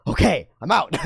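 put-on high, quick kid signing off; carefree kid. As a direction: pop out of it like a child. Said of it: "and the kid goes".

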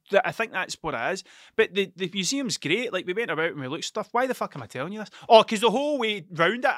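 ordinary delivery

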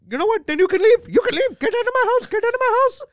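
high, loud mock Indian-accented rant; hammed-up irate homeowner shouting; angry yelling. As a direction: throw him out with an imitated furious yell. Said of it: "started getting mad at me and started yelling".

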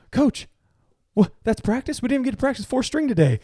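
high, fast mock protest; imitated complaining player. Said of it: "he runs over and he goes".

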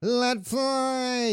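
high squeaky game line imitation; mocking quote. Mocking the game's voice line, high and squeaky. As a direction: mimic the grating voice line. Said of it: "I hate this more than Let Fly".

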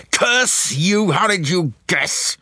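high, slow venomous snarl; Davidson snarling. A narrator's reading. Unmasked murderer curses his accuser, snarling. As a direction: spit out each word in fury. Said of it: "snarled Davidson's voice".

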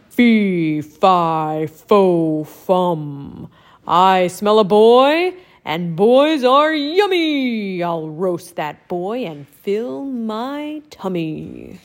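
loud, slow giant chant; low mean giant. Acted. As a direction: boom it out like a hungry giant. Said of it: "in a low, mean voice".

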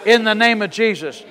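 forceful faith confession for a congregation to follow; preaching declaration. Real, not acted. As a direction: declare it boldly phrase by phrase. Leads congregation in bold declaration.

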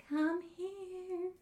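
soft, high, eerie ghost calling. Retold or imitated. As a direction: call out softly like a ghost luring someone. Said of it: "my best American Ghost accent".

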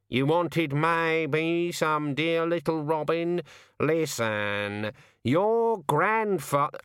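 high, very slow raven voice; slow, high-pitched talking raven; wheedling raven. A narrator's reading. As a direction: stretch the words like a pestering bird.

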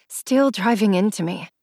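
ordinary delivery

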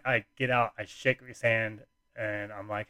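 plain reading voice

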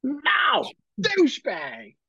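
put-on high, crying-out child voice; put-on high-pitched tantrum; mock whiny kid. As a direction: wail the insult like an upset little kid. Imagined child insulting a playmate.

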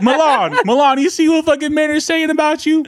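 high-pitched tattling call; calling out teasingly. Real, not acted. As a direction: call out to her, stirring trouble.

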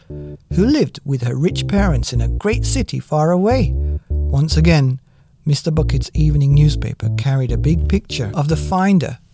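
plain reading voice

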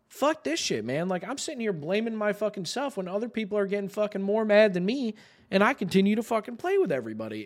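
high-pitched exasperation without raising the volume; retold fed-up outburst. Gamer tired of blaming himself, exasperated and fed up. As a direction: let the frustration lift the pitch through the rant.

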